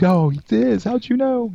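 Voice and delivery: put-on high, eager kid's voice; little boy voice. Host voicing the baker's son in a joke, high and eager. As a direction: pitch it up like a proud little boy. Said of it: "He goes".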